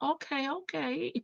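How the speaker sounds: soft, hushed smooth-talker voice; bedroom voice. Retold or imitated. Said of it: "Nesto dropped his voice down like".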